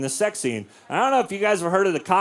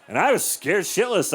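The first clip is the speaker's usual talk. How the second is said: usual speaking voice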